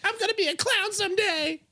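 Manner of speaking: put-on very high wailing kid voice; sobbing little boy, hammed-up; crying child.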